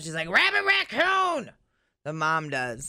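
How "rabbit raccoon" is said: high, drawn-out mock yell; imitated yell. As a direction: holler it out like the panicking mom. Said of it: "then she just yells out".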